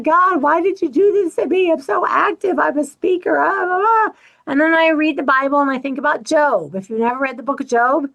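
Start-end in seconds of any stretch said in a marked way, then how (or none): 0.0-4.2 higher, self-mocking complaint to God; mock whining. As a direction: whine it up high, half joking. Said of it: "I say the things like".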